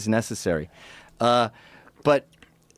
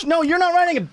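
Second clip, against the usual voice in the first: high mocking retort; 3 dB louder than usual. Acted quarrel, other party.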